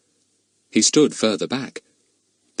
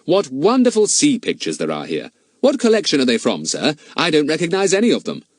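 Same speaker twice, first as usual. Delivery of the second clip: higher, eager schoolboy; bright-eyed boy, curious and pitched up; Fatty, admiring boy; 6 semitones higher than usual. Acted. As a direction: enthuse politely to a gentleman.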